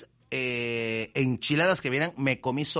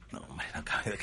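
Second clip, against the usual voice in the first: disbelieving whisper; 8 semitones lower, 9 dB quieter than usual. Real, not acted.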